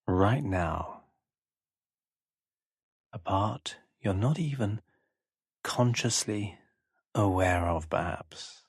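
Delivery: slow hypnotic voice with long pauses between short phrases; hypnosis induction. Acted. Hypnotist guiding a listener to close their eyes, slow hypnotic delivery.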